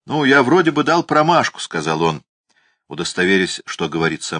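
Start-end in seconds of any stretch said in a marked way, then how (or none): 0.0-1.6 mumbling through a mouthful; words round hot potato; mouth full of hot potato. A narrator's reading. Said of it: "рот его был набит горячей картошкой". (как с горячей картошкой во рту)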